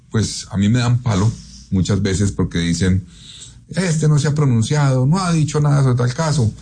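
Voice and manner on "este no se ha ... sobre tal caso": higher-pitched imitation of complaining critics; mock complaint. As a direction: mimic the critics' grumble, pitched up. Imitating critics who complain about him.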